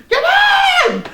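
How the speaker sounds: sudden high, loud mock yell; high-pitched hammed-up call; mock shout. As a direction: bellow it like a cocky wrestler.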